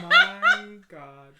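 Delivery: loud, high "oh" of disbelief; husband reacting loudly to the fart story; shocked outburst. Real, not acted. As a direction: burst out in real shock.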